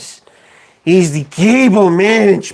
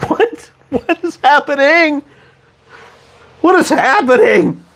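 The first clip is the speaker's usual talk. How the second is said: startled confusion; 9 semitones higher than usual. Real, not acted. Startled by on-screen voice.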